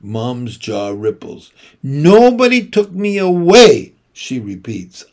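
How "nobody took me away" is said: high, loud, firm denial; angry insistence. Acted. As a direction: snap it back.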